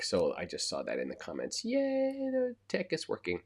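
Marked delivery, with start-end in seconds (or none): 1.7-3.4 high, bright cheer of relief; excited cheer. Real, not acted. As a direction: cheer it out, pitched way up. Relief as stream finally works.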